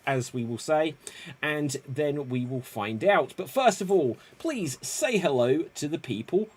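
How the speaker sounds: ordinary delivery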